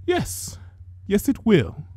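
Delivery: a hint of a fussy older sister; gentle big sister tending a sick sibling; Rarity's voice. A narrator's reading.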